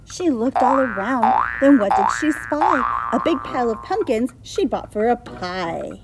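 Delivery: loud dramatic storytelling; story narration. A narrator's reading. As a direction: build suspense for kids.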